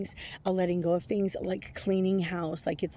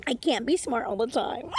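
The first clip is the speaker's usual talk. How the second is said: higher put-on comic voice; hammed-up mock protest, pitched up; inside-joke voice; 6 semitones higher, 3 dB louder than usual.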